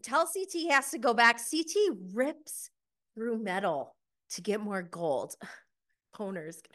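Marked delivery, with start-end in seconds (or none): none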